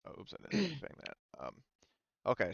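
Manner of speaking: normal talking voice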